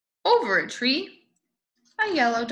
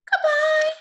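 squeaky goodbye; 13 semitones higher, 5 dB louder than usual. Acted. Voicing dog farewell.

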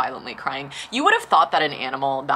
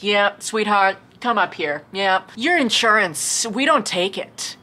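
higher, louder, sugary-sweet brush-off; patronizing receptionist; 5 semitones higher, 4 dB louder, slower than usual. Retold or imitated. Receptionist calling patient up, patronizing and higher. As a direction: talk down to her like a child, higher and louder.

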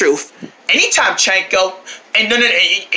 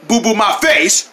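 normal talking voice

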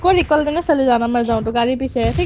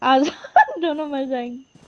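laughing; slower than usual. Real, not acted. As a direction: laugh through the words. Laughs at both dying. (हँसते हुए)

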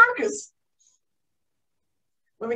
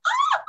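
delighted squeal. Acted.

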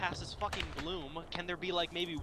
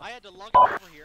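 excited exclaim; 11 dB louder, faster than usual. Real, not acted. Bragging about carrying teammate.

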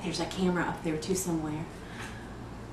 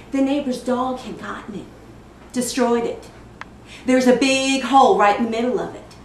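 louder, building indignation; indignant complaint; 2 semitones higher, 7 dB louder than usual. Acted. Mother finding the backpack the neighbor's dog destroyed, indignant. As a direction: hit the damage harder, building to loud. Bitter account of ruined gift.

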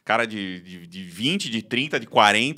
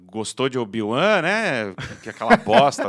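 mock heckling; 2 semitones higher, 6 dB louder than usual. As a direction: imitate an obnoxious commenter needling someone. Voices a heckler commenting on photo.

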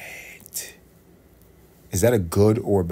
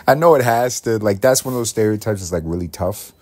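normal talking voice

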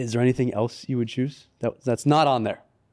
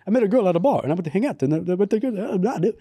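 put-on English accent, pitched up; lilting, higher mock British accent; 7 semitones higher, faster than usual. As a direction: retell it in a hammy English accent. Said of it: "He has an English accent".

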